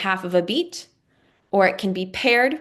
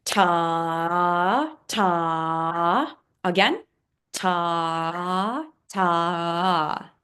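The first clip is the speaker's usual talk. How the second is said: two held ta per measure; held half-note ta; much slower than usual. Shown as a demonstration. Teacher counting half notes on the staff, held ta. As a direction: stretch each ta two beats. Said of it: "count it out just like I did".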